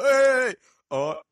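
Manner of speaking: high, loud southern English shout; mock southern accent. Teasing friend's failed Bosnian impression, loud southern accent. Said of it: "That was completely southern all the way through".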